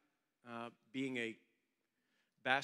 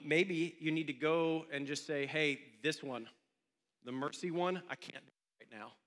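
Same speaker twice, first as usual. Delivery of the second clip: louder, higher, driving the appeal home; full-force preaching; 3 semitones higher, 6 dB louder than usual. Acted. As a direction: preach it hard to the whole room, pushing each line.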